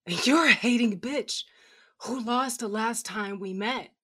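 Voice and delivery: put-on loud drunken jeer; sloppy drunk taunt; slurred insult. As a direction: spit the insult at her, slurring. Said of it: "muttered another slurred expletive".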